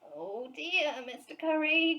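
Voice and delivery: startled little bear gasping an apology; gasped. A narrator's reading. Bear caught in the apple tree, gasping in alarm. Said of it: "gasped Paddington".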